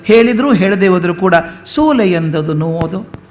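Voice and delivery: normal talking voice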